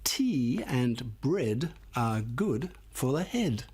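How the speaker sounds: slow, clear title reading; deliberate classroom pace. Shown as a demonstration. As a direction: read it out slowly, word by word. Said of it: "how about this one".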